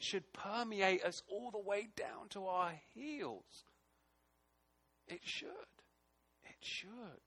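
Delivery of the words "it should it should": much softer, pressing the point quietly; earnest hush. Real, not acted. Preacher quietly warning the brethren, much softer. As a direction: let it sink in softly.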